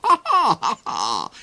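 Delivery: high cackling laugh; evil laugh. A narrator's reading. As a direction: cackle like a villain. Duke's villain laugh.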